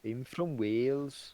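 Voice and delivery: slow, drawn-out attempt at a Welsh accent. Shown as a demonstration. Trying Welsh for a friend, slow attempted Welsh accent. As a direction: stretch it out in a would-be Welsh lilt. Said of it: "How do you do a Welsh accent?".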